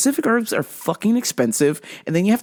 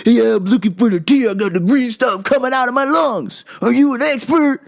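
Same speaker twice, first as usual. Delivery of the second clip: imitating a yelling, irate customer; 5 semitones higher, 4 dB louder than usual. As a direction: bellow at the meek clerk, louder and higher. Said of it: "he's, like, yelling at my coworker".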